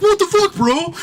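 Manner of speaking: high, loud shout of disbelief; retold outraged yell. Husband yelling at the waiter who soaked his wife, outraged shout. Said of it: "And her husband's like".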